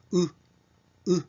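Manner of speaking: short "う" with lightly pursed lips; lightly rounded vowel. Shown as a demonstration. Teacher showing the second u, short lightly pursed "う". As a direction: say "う" like the Japanese u. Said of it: "口を軽くすぼめて".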